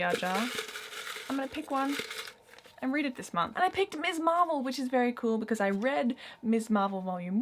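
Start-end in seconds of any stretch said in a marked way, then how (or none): none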